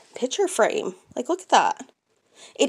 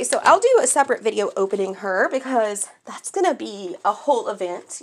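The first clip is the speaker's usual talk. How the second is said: ordinary delivery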